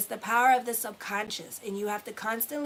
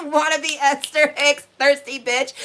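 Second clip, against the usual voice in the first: loud, higher voice through real laughter; laughing hard, voice lifted and loud; 4 semitones higher, 10 dB louder than usual. Real, not acted. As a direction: crack up while repeating your own joke. Laughing at own joke.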